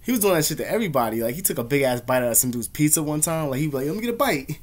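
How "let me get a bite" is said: higher-pitched mimic of a food moocher; pushy bully. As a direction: lightly voice a bully demanding a taste. Acting out bully demanding food.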